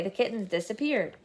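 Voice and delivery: plain reading voice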